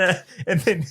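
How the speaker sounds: ordinary delivery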